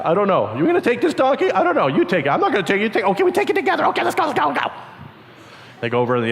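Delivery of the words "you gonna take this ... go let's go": high, jittery back and forth; nervous bickering. Acted. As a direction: bicker like scared kids. Two nervous disciples bicker.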